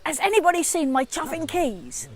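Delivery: rising exasperation over lost keys; exasperated shout. Retold or imitated. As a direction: pitch it up, fed up with everyone. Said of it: "a little bit angry".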